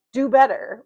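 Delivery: loud, demanding snap; mock scolding yell. Telling her guides to do better, loud scold. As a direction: scold them like a sibling. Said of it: "yell and be like".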